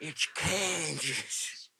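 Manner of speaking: soft, drawn-out movie-quote voice; Little Nicky impression. Friends recalling a film line, slow soft impression. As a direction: stretch it out very slowly and quietly. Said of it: "what's he saying here?".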